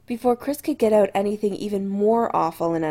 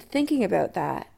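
plain reading voice

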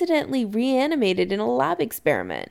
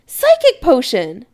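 loud, higher-pitched outburst; exclaiming; 5 semitones higher, 9 dB louder than usual. A narrator's reading. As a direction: blurt it out loudly as a correction. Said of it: "Chris exclaims".

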